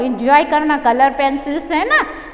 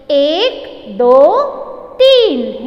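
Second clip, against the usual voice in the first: drawn-out count, building louder; teaching count; 5 dB louder, much slower than usual. Shown as a demonstration. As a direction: count out each number separately for children. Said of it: "आपको बोलना सिखा रही हूँ". (हर गिनती अलग-अलग, खींचकर और ज़ोर से)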